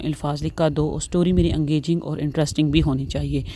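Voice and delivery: ordinary talk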